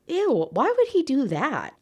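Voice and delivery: high-pitched disgusted aside; squeamish, indignant reaction; grossed out. Real, not acted. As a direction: break off in appalled disbelief, swooping high. Reader reacts to the story.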